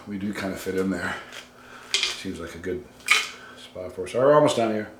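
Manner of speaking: ordinary talk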